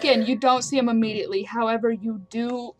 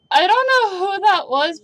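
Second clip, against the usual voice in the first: higher, louder character voice; Elise; 9 semitones higher, 9 dB louder than usual. Acted. Game master voices a character speaking up, raised higher voice. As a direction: speak up, pitched well above usual. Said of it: "Elise speaks up and says".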